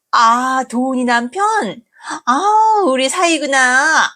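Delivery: high, crying wail, then slow. Acted. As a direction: cry out dramatically as she speaks. Mother wails on meeting son-in-law.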